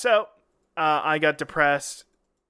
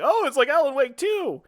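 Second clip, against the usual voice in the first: high, swooping eager delight; light up with glass-half-full enthusiasm; upbeat optimist; 8 semitones higher than usual. Acted. Optimist cheering up his cohost about the trip, excited. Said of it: "I'm the more half glass full".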